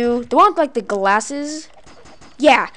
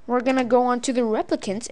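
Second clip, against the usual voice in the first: ordinary talk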